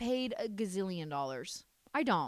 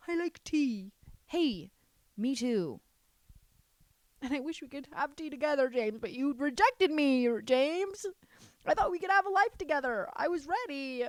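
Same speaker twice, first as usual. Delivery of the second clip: higher, lightly whiny pleading to an ex; mock heartbroken plea; 6 semitones higher than usual. Teasing James, the Englishman who said no, with mock heartbreak. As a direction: pretend to beg him to come back, half-joking.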